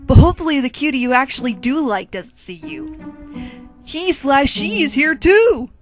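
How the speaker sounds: ordinary delivery, nothing marked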